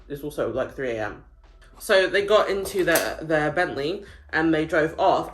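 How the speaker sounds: usual speaking voice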